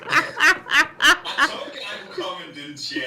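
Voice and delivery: high, loud, slow, laughing gloat; triumphant laughing. Real, not acted. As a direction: crow it out through laughter. Triumphant after being teased.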